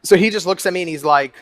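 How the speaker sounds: ordinary talk, nothing marked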